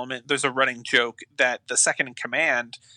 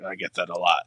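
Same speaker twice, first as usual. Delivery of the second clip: put-on deadpan line in a light Russian accent; a hint of a Russian accent, low and dry; 8 semitones lower than usual. Quoting the Ethiopian Russian henchman in a light Russian accent. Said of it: "In a pretty killer Russian accent".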